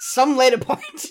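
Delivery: slow, giggling through the words; laughing. Real, not acted. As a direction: laugh while you say it.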